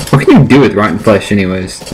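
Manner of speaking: normal talking voice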